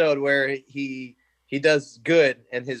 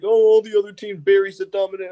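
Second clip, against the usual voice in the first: put-on higher, louder kid voice; imitating his kid self; 7 semitones higher, 5 dB louder than usual. Recalling himself as a kid watching, excited child voice. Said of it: "I was the kid watching, like".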